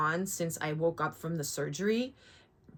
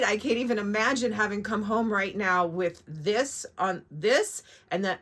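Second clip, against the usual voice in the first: ordinary delivery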